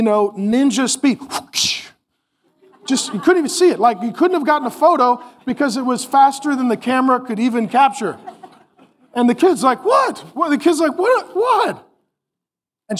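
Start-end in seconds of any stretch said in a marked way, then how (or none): 1.2-1.9 unvoiced swoosh noise; a quick breathy whoosh of a swipe. Preacher voicing the mom's lightning-fast swipe, a whoosh. 9.8-10.2 put-on high, startled kid's what; confused kid. Preacher voicing the kid hit out of nowhere, high and startled. 11.0-11.9 put-on high, startled kid's what; confused kid. Preacher voicing the kid hit out of nowhere, high and startled.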